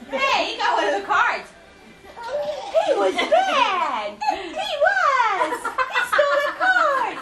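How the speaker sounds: laughing loudly; giggling while telling. Real, not acted. Laughing about the dog's theft.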